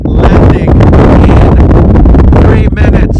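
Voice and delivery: called out loud and slow; calling out. Real, not acted. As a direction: announce it over the wind. Announcing plane landing outdoors.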